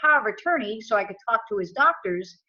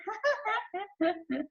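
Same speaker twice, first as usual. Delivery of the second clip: quick breathy giggle, pitched up; a brief, real laugh at the absurdity; short high laugh; 10 semitones higher, 7 dB quieter than usual. Real, not acted. Laughing as he recalls the family calling his uncle crazy.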